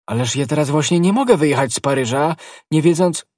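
loud, high objection; protest breaking off. A narrator's reading. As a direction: protest forcefully, then stop short mid-thought. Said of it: "Aramis zaciął się".

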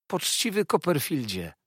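joyful narrated outburst; happy exclamation. A narrator's reading. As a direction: exclaim fondly to an old friend. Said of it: "zawołał".

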